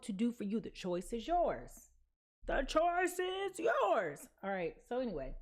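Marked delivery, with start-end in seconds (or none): 2.4-4.3 high, very slow and drawn out; slow dramatic drawl. Pretend. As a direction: stretch every word dramatically. Repeated in a drawn-out voice.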